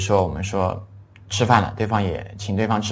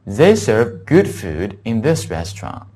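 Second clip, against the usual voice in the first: slow, clearly spaced English model sentence; measured teacher's read-aloud; 3 dB louder, slower than usual. Shown as a demonstration. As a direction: read the whole sentence slowly and carefully. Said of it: "这句话整个应该这么读的".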